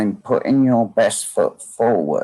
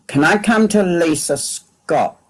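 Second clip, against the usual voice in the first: raised, higher-pitched call; 9 semitones higher than usual. Real, not acted. Medium seeking a sitter in the online congregation, calling out. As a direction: call out a name to find someone.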